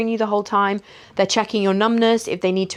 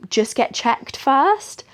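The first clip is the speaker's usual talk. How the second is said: slow, raised-pitch condescension, rising at the end; patronizing doctor; 7 semitones higher, much slower than usual. Retold or imitated. Doctor to patient in labour, patronizing. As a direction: imitate a doctor's high, drawn-out query. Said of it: "the doctor was a little bit patronizing".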